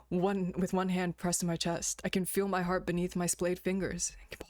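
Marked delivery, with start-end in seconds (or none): none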